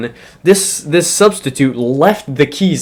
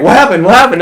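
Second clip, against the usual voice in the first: higher, louder mock innocent asking; fake innocent; 8 semitones higher, 6 dB louder, much faster than usual. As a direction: play dumb like nothing happened, pitched up. [name] playing innocent after falling.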